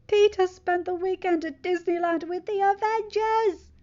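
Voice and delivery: high, loud eager announcement; excited. Acted. Friend excitedly telling MJ the news. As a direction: blurt it out, thrilled. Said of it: "Ned said excitedly".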